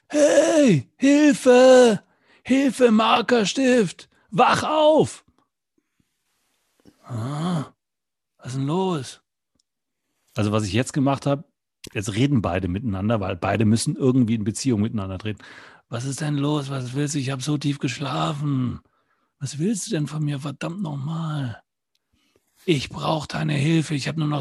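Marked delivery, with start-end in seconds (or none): 0.1-5.2 high, loud cries for help; calling for help. Acted. Desperate watch calling to the sleeping marker, high loud shout. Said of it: "Ich fange an zu rufen". 7.0-9.2 lightly voiced, just woken up; groggy marker. Sleepy marker answers the watch, mildly voiced. 15.9-21.6 lightly voiced, a hint of irritation; grumpy woken marker. Woken marker grumbles at the watch, mildly annoyed.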